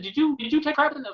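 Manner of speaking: high, put-on disbelieving voice; a coworker asking in a high comic voice; incredulous officer. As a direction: squeak out the question in shock. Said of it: "he said, he said".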